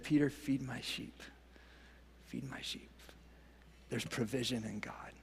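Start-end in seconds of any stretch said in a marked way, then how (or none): 2.3-2.9 hushed breathy whisper, much softer. Shown as a demonstration. Jesus charging Peter, whispered tenderly. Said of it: "repeat after plain take". 3.9-5.1 soft unvoiced whisper. Real, not acted. Pastor assuring the congregation in a whisper. Hushed closing line of sermon.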